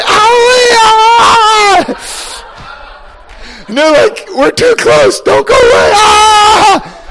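high crying shout of awe; overwhelmed creatures. Acted. Creatures overwhelmed by God's presence, crying and high. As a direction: cry out as if burned by glory.

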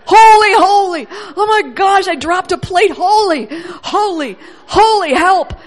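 loud high frantic yelps of holy; hammed-up panic over a dropped plate; mock panicked exclaiming. Preacher acting out a startled person, loud and high.

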